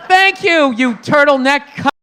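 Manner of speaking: high, loud sarcastic shout; mock being deeply moved, then snap; sarcastic outrage. Mocking Steve Jobs with loud sarcastic gratitude.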